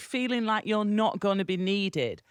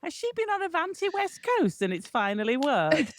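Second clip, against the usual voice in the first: mock nosy surprise; 9 semitones higher than usual. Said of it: "I thought".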